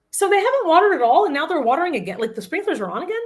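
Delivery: can't believe the sprinklers are on; mock bewilderment, pitch jumping up; incredulous. Retold morning bewilderment over sprinklers.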